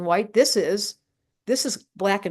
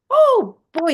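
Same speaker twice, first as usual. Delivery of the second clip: much higher and louder burst of delight; excited; 12 semitones higher, 8 dB louder than usual. Real, not acted. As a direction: let the fun explode out. Excited about the fire sign.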